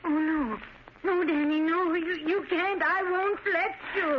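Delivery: high, rising plea, clinging to him; frantic pleading. Acted. Mother begging her son to stay, high and frantic. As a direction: beg him desperately not to go.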